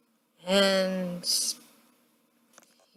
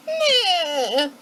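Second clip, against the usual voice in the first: higher, half-crying meh; whimpered meh; 8 semitones higher than usual. Retold or imitated. Hamilton answering Washington, a mock crying meh. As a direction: whimper it like a sulking kid. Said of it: "And Hamilton's just like".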